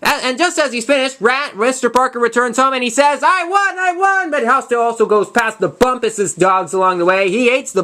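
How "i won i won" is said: excited. Retold or imitated. Father announces prize excitedly.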